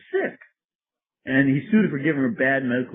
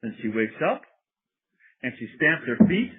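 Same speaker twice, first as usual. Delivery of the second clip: ordinary delivery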